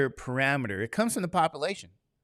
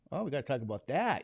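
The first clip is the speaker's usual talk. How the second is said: ordinary talk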